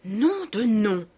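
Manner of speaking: slow, drawn-out angry oath; howled curse. A narrator's reading. Reader finds the document spoiled, howled curse with restrained volume. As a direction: curse through it, stretching each word. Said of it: "hurla Beautrelet".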